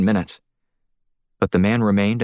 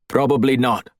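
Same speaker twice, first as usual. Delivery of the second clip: lightly voiced guard, a little higher and strained; Russian guard; 4 semitones higher than usual. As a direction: play the pinned guard denying it, barely changed from the narrator. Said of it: "the man said".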